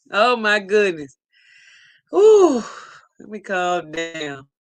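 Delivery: ordinary talk, nothing marked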